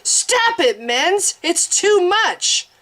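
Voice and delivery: high, desperate cry, slow at the end; frantic plea. A narrator's reading. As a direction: beg him to stop, alarmed. Priestess pleading to stop torture.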